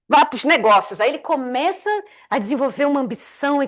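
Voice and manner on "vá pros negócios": mock serious man. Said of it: "acordou seríssimo".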